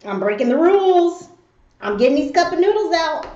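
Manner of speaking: a touch of naughty glee; imitating gleeful child. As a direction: act like a kid sneakily proud of himself. Voices her son's proud rule-breaking thoughts.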